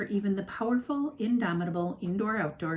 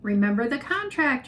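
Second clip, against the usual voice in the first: louder warning call; mother shouting a reminder upstairs; 4 semitones higher, 6 dB louder than usual. A narrator's reading. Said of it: "her mother shouted".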